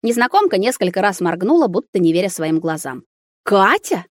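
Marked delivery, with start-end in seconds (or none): 3.4-4.0 astonished questioning call; amazed recognition, rising into a question. A narrator's reading. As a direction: say the name in disbelief. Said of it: "В её голосе тоже немало изумления".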